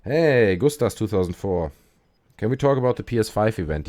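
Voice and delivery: usual speaking voice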